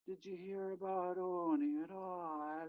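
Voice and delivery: put-on slow, high little girl asking; lightly voiced young niece, drawn out; niece's recorded voice. Uncle imitating his niece's recording, slow and high. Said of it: "one of my nieces kind of comes in with".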